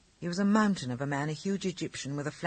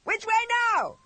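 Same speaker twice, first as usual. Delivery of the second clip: gleeful shout; 6 dB louder than usual. A narrator's reading. William shouting to the driver while racing the carriage. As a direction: yell it happily from the driver's seat. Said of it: "shouted William".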